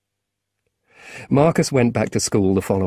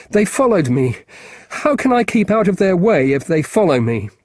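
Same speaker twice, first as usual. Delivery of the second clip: upset, quicker; 8 semitones higher, 4 dB louder than usual. Acted. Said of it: "began to get upset, and to speak more quickly".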